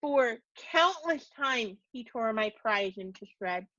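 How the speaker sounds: usual speaking voice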